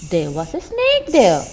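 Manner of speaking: usual speaking voice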